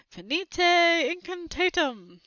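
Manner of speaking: slow, high spell incantation; casting a spell. Acted. Boy casting an undoing spell on Harry, slow and high. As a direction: intone it like a magic word. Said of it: "repeated the words Trevor thought would work".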